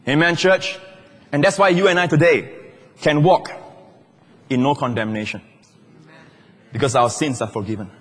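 usual speaking voice

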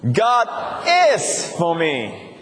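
"is" leaned on, slow pace; stress on is. Shown as a demonstration. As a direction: press the word "is". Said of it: "and then is".

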